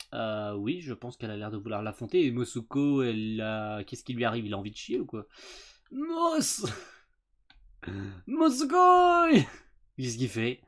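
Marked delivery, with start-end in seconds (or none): 5.9-9.3 long drawn-out shout; calling out. Real, not acted. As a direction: call his name, stretched. Calling out character name.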